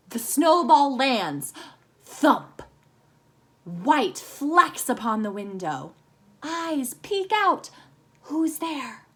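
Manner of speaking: slow, rhythmic haiku reading; bouncing poem reading. Shown as a demonstration. Teacher rereading the haiku so kids hear its beat, slow and rhythmic. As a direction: let the lines bounce along gently. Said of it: "so you can kind of hear how the haiku bounces".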